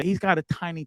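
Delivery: usual speaking voice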